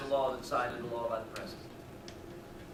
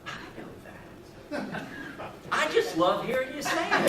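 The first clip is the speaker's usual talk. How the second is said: put-on laughing old man, higher; chuckling; 10 semitones higher than usual. Old man to the Marine, chuckling with glee. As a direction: chuckle through the line with glee. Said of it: "chuckles and goes".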